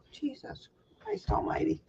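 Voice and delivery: breathy whispered curse under her breath; exasperated whisper. Real, not acted. Annoyed at the light, whispering a curse to herself.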